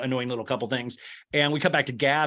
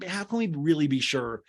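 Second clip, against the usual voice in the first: ordinary talk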